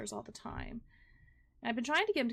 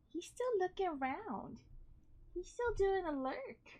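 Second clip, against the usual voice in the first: high pet voice; 8 semitones higher than usual. Real, not acted. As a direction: coo at the cat. Cooing about the cat.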